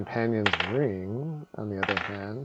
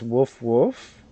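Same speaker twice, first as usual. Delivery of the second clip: barking chieftain; 3 dB louder than usual. Acted. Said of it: "the chieftain says".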